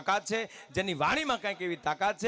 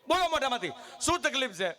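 mock doctor's demand; 9 semitones higher than usual. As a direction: push him to speak out. Doctor pressing patient.

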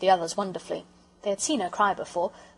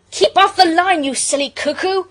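loud, high, fierce shout; 10 semitones higher, 11 dB louder than usual. A narrator's reading. Peter yelling at Bobby on the line, fierce. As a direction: shout a furious warning. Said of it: "said Peter fiercely".

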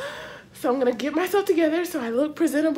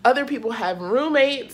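normal talking voice

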